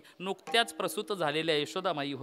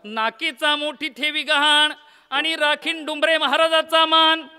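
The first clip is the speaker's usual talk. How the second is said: proud loud vow; 8 semitones higher, 8 dB louder than usual. Acted. Preacher voicing a proud vow to the crowd, loud. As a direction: declaim it high and loud.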